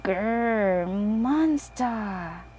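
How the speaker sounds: little piglet playing a growly monster; small boy pig doing a monster growl. A narrator's reading. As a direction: growl it like a toddler's pretend monster. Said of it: "says George".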